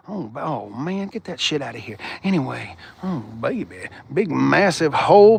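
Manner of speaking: ordinary delivery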